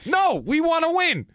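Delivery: high, loud slow mock coach. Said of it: "he goes".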